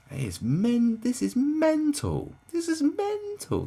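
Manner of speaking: high, loud, drawn out disbelief; can't believe what you're seeing; stretched high cry of disbelief; incredulous outburst. Real, not acted. Incredulous outburst about people.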